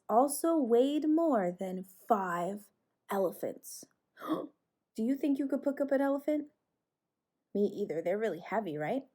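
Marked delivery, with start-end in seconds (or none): 4.2-6.5 surprised gasp. Acted. Mock amazement for kids.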